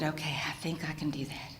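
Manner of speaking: breathless unvoiced okay; whispered agreement. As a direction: whisper it, unsure but willing. Hesitant agreement to the vet.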